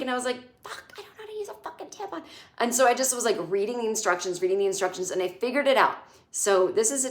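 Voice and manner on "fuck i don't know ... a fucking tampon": high, very soft, hurried squeak; re-enacted hushed freak-out; hushed panic. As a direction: panic under your breath, quickly. Retelling her panic at a friend's house.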